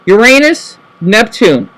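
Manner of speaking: ordinary talk, nothing marked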